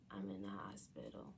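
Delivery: low unvoiced whisper. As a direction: whisper it into the phone. Whispered call to boyfriend.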